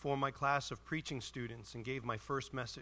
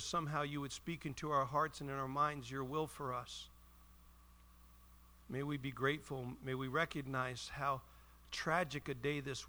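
prayer. Real, not acted. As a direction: pray gently over the listeners. Leads the congregation in prayer.

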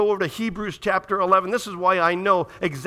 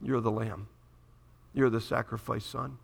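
hushed grief; 7 semitones lower, 7 dB quieter than usual. Acted. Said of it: "what was going through Abraham's mind".

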